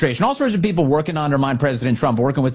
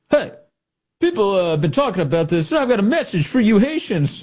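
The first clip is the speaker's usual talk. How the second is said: higher, cocky mock announcement; mock Mullin video; 8 semitones higher than usual. Host imitates Mullin's video message, higher and cocky. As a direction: swagger it like a cool office video. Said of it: "Is like".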